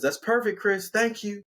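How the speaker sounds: high-pitched, lightly voiced thanks; pleased fiancee. Amy gives Chris a thumbs up, pleased. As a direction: pitch it up for an approving thank-you.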